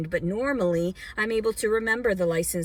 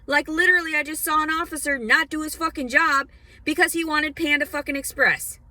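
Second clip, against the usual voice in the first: angry rant; 7 semitones higher, 4 dB louder than usual. Real, not acted. Ranting about a lazy officer.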